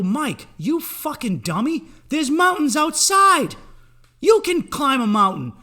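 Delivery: high, loud and slow, scolding himself; exasperated self-yell. Retold or imitated. Comic telling himself off, a loud, slow yell. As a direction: bellow at yourself like a frustrated coach.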